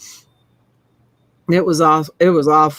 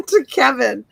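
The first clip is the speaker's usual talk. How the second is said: laughing; 5 semitones higher than usual. Real, not acted. Laughing at own slip.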